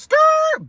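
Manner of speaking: high, loud tire skid sound; tire screech. Retold or imitated. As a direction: screech it like brakes slamming. Imitating car tires screeching.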